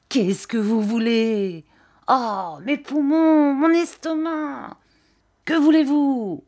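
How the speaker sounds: high, drawn-out wails and demands; gusting singsong old man. A narrator's reading. Old man holding customer by the hair, high wailing singsong. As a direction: swell each phrase slowly upward like a gust. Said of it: "toutes ses phrases sur une espèce d'air".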